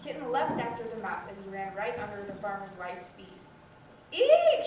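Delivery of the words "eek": high, loud startled shriek of fright; scream. Acted. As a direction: shriek as a mouse runs under your feet. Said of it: "she screamed".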